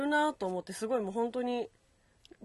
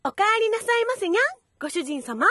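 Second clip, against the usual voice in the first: much higher, louder cutesy maid voice, fast; sugary maid cafe greeting; 11 semitones higher, 8 dB louder than usual. Shown as a demonstration. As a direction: unfamiliar squeaky catgirl maid voice. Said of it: "デカミちゃんの聞きたくない？".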